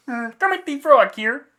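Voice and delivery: high, loud froggy puppet voice; Kermit impression. Podcast cohost jumps in as the frog puppet, high and loud. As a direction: pop in as the cheery frog puppet. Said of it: "we can't do a good Kermit impression".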